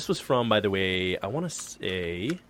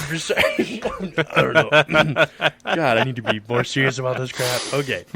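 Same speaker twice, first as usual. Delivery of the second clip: cracking up mid-read, higher and louder; laughing; 3 semitones higher, 7 dB louder than usual. Real, not acted. Podcaster losing it at his own joke while reading, laughing. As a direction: try to talk through real laughter.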